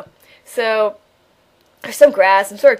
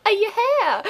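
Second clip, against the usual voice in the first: sarcastic yeah; 11 semitones higher than usual. Real, not acted. Sarcastic self-answer.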